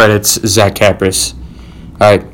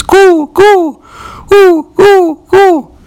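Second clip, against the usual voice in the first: very high falsetto "coo, coo" like pigeons; 3 dB louder, much slower than usual. Pretend. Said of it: "here's some bird pigeons".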